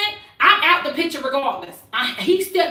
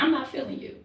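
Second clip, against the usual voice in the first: much softer, lower "I'm not feeling you"; softer calm take; 4 semitones lower, 11 dB quieter, faster than usual. Shown as a demonstration. Woman asking friend to try her line, softly. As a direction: murmur it, totally calm. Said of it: "Now, Asia, you say it".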